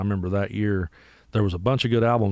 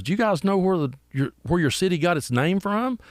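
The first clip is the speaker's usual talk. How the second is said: high-pitched question to the room; drummer quizzing crowd; 9 semitones higher than usual. Retold or imitated. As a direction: mimic a drummer putting a question to the bar.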